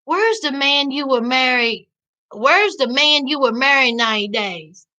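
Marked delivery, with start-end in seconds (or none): none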